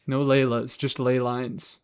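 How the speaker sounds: usual speaking voice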